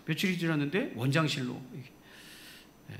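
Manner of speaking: usual speaking voice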